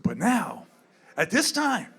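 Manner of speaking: ordinary delivery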